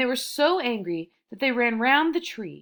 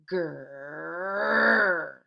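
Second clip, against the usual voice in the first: growl; 4 semitones lower than usual. A narrator's reading. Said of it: "a horrible noise that sounded like".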